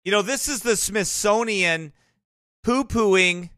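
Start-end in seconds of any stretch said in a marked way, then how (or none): none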